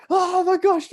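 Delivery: high, loud mock horror; shocked gasp. Mates reacting to his shouted heckle, shocked. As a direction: exclaim it high, as if scandalised.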